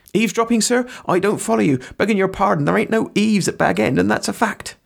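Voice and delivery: high, indignant rustic voice; humble gardener, high-pitched and put out; flustered servant. Acted. Sam protests his innocence.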